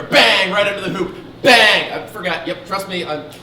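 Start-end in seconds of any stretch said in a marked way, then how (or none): 0.0-0.5 loud punchy call; shouted bang. Acted. Sportscaster calling shots. 1.4-1.9 loud punchy call; shouted bang. Acted. Sportscaster calling shots.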